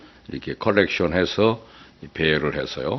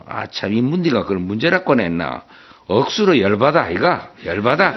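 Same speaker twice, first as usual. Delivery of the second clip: higher, louder hammed-up Gyeongsang rant; angry Gyeongsang husband; 5 semitones higher, 4 dB louder than usual. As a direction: blow up in mock fury, Gyeongsang style. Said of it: "갑자기 화를 내면서".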